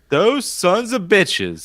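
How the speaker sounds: mock outrage.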